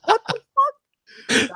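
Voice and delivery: high, slow drawn-out disbelief; stunned disbelief. Retold or imitated. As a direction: stretch it out in shock.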